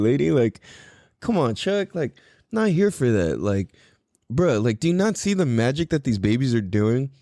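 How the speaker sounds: ordinary talk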